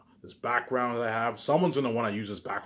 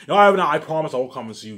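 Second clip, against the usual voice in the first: louder, higher mock-eager promise; wife's eager promise, voiced louder and higher; imitating her promise; 6 semitones higher, 12 dB louder than usual. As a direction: play her rushing to reassure him, hammed up.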